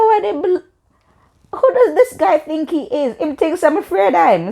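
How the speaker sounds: very high, indignant re-enactment; outraged disbelief. Retold or imitated. Girl reacting to being pushed again, high-pitched indignant retelling. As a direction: shoot the pitch up in affronted outrage. Retold outrage at second push.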